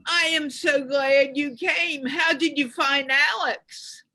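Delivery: ordinary delivery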